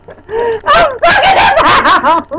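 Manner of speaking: fake-sob it like a drama queen; mock wail. Friend leaving, mock despair.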